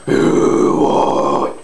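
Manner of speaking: very low, rasping metal growl; false chord growl. Shown as a demonstration. Coach showing Yogi Bear turned into false chords, deep growl. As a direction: let the throat bubble into a drawn-out low growl. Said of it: "That's what it sounds like".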